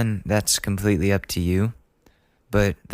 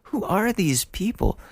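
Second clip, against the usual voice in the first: baffled; 11 semitones higher than usual. Retold or imitated. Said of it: "I scroll through my contacts and I'm like".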